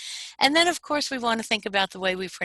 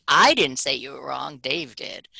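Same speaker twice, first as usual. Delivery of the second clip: "I" hit loud, the rest dropping away; stress on I; 2 semitones lower, 4 dB louder than usual. Shown as a demonstration. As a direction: shift the blame by punching "I". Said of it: "the first time it's like".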